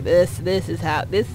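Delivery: ordinary delivery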